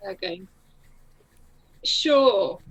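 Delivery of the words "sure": sarcastic. Retold or imitated. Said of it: "really sarcastic".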